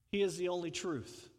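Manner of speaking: usual speaking voice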